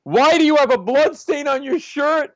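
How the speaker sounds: high, loud angry interrogation, building; mock scream, climbing in pitch; screaming boss. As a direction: act out a furious senior partner. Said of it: "He's screaming at me".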